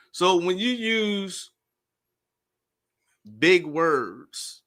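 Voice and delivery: "Most people" drawn out very slowly; deliberately slow. Real, not acted. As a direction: spell it out slowly so everyone follows. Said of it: "So I'm not gonna speak fast".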